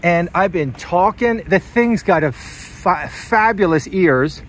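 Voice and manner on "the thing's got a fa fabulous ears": ordinary talk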